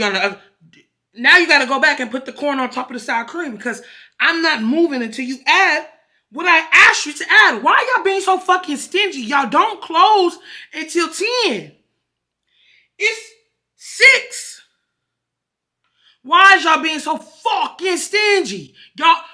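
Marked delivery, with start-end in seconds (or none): 1.1-13.3 loud, high exasperated complaint; venting at the server, loud and raised; heated rant. Real, not acted. To the Chipotle server, loud and exasperated. 13.8-19.2 loud, high exasperated complaint; venting at the server, loud and raised; heated rant. Real, not acted. To the Chipotle server, loud and exasperated.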